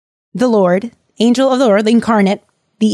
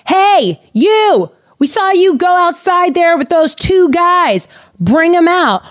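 much higher, mock-yelled crowd demand; mob yelling; 9 semitones higher, slower than usual. Sodom mob shouting at Lot to bring the guests, mock yell. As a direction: holler it like an angry mob. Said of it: "they are yelling, they are screaming".

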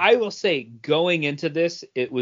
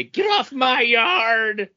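higher, slow mock shout at kids; grumpy old man yell; 7 semitones higher, slower than usual. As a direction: shake a fist and bellow at the kids. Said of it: "raise your fist and shake it like Doom and say".

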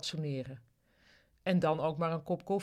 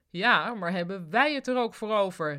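mild shout, louder than usual; calling out; 3 semitones higher, 7 dB louder than usual. A narrator's reading. Ruud retorts to his sick colleague, calling out loudly. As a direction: call the retort out with raised volume. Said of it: "riep Ruud".

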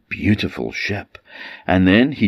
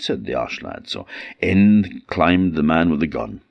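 ordinary delivery, nothing marked

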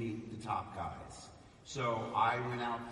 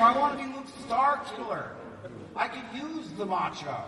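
higher, louder petulant insistence; whiny demand; 8 semitones higher, 10 dB louder than usual. Retold or imitated. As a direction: demand it like a spoiled kid. Whining about his name.

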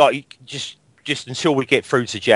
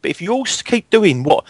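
worked-up rant; 11 semitones higher than usual. Real, not acted. Ranting about singing sections.

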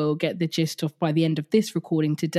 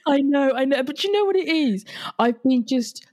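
laughing apology; 8 semitones higher, 3 dB louder than usual. Real, not acted. Laughing apology after teasing.

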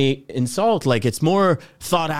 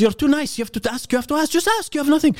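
higher-pitched, insistent friend's voice; retold urging; 13 semitones higher than usual. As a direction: push them to just ask, high-pitched. Retelling the friend's exasperated urging.

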